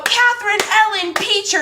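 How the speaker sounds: high, loud belting of a full name; mock scream. Imitating boyfriend yelling her full name in a store, mock scream. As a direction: belt it out like he does, for all to hear. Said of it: "He will scream".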